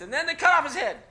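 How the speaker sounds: mock-outraged classroom climax; dramatic punchline. Real, not acted. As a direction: build to a shout on the twist.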